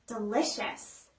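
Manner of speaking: ordinary delivery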